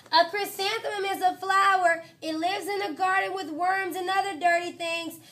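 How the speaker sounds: put-on high, loud know-it-all girl voice; snooty classmate. Victoria lectures the class in a high, loud show-off voice. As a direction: show off to the teacher.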